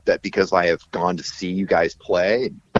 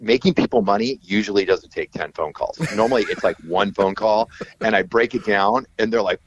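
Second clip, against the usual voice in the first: ordinary talk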